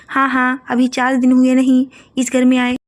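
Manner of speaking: loud, sharp scolding; scolding mother-in-law. Acted. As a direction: tell off the new bride loudly. Mother-in-law scolds new bride.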